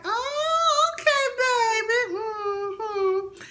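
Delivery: put-on very high cooing "okay, baby"; mock sweet approval. Vlogger cooing high over the couple's awkward introduction. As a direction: coo it high and doting. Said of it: "I'm fine, like".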